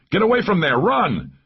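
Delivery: high-pitched shouted warning; alarmed, high, forceful command; urgent shout. A narrator's reading. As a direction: shout them out of danger. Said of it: "I shouted".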